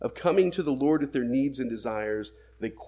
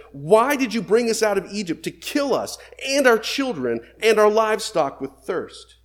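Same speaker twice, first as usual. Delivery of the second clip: raised, louder and higher accusing cry; angry accusation; 8 semitones higher, 7 dB louder than usual. Acted. People accusing Moses of bringing them to die, raised and angry. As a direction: hurl the blame at Moses.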